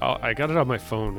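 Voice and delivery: ordinary talk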